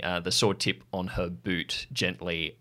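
usual speaking voice

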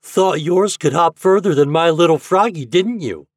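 put-on high, loud boyish taunt; teasing. A narrator's reading. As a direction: rib the friend who lost. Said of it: "teased Fat".

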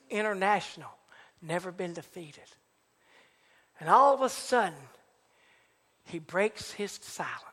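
ordinary talk, nothing marked

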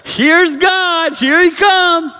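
very high, loud cry of arrival; excited shout. Acted. Onlooker in the massive wind spotting God, excited shout.

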